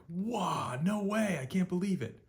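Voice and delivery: high, loud amazed gasp of disbelief; surprised disbelief. Retold or imitated. Teacher acting out amazement for students, high and loud. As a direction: squeal it like you can't believe it.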